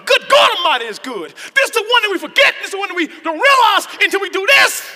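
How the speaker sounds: preaching. Acted. Preacher stressing the key point, preaching. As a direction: lift it up to the church.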